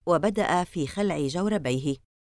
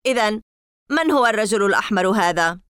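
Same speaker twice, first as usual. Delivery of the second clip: impatient, louder, higher; 4 semitones higher, 6 dB louder than usual. Acted. Boy demands answer from Larry, impatiently louder. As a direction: press him, out of patience. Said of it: "قلت بنفاد صبر".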